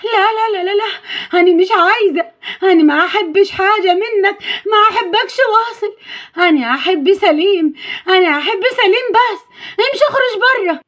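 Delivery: put-on high, frightened childlike protest; acted terrified girl pushing him away; trembling with fear. A narrator's reading. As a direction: plead and refuse in a scared high voice. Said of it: "نيلي بدأت ترتعش من الخوف".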